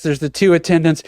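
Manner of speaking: plain reading voice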